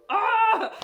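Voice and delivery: sudden high, loud mock scream of frustration; frustrated scream. Scream at people who say it gets better with time. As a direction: let out a sharp, hammed-up shriek. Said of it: "You're like".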